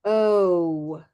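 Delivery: teacher showing the vowel; rounded oh. Shown as a demonstration. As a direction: round the lips on "Oh". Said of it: "everyone see that with my mouth".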